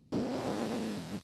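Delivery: puffed breath pushed out through the lips; a long sighing exhale as a breathing demonstration; breath demo. Shown as a demonstration. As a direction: push the air out of puffed cheeks. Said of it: "you can sniff in while you squeeze the air out".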